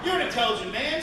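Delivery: usual speaking voice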